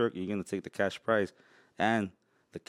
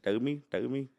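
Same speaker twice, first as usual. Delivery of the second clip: mock Indian shopkeeper accent; hammy Indian accent, shooing a customer; 3 dB quieter than usual. Shown as a demonstration. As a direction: put on an Indian store-clerk accent. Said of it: "the Indian voice and be like".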